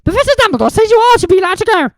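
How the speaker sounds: grudgingly disapproving; sniffy. Acted. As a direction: Madame Pomfrey grudgingly allows Harry, sniffy. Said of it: "she said sniffily".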